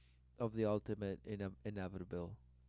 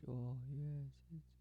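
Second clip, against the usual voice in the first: higher, soft, drawn-out swooning; higher mock-blissful murmur; mock self-satisfaction; 4 semitones higher, 7 dB quieter, slower than usual. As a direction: play someone softly giving in to flattery. Voices those swayed by the whispers.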